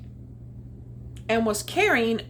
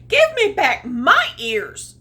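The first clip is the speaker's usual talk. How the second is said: squeaked; 4 semitones higher, 9 dB louder than usual. Acted. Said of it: "squeaked the bunny".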